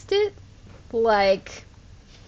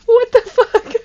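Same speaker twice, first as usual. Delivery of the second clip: much higher, much louder outburst; loud, high exasperated cry; shocked shout; 12 semitones higher, 12 dB louder than usual. Real, not acted. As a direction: yell it in disbelief. Shocked at wasted mining time.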